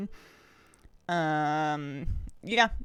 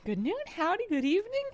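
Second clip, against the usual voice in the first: high playful greeting; 11 semitones higher than usual. Acted.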